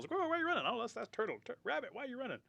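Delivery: very high, hurried-sounding questions; frantic animal voices. Retold or imitated. Animals questioning each other in retried tale, high and frantic. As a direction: squeal the chase dialogue. Improvised running animals' dialogue.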